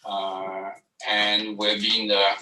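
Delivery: usual speaking voice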